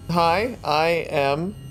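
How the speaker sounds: ordinary talk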